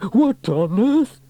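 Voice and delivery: high, loud, drawn-out cry of shock; jolted by a jet of water; startled yell. A narrator's reading. Man drenched by surprise water, high startled yell. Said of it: "yelled Trevor".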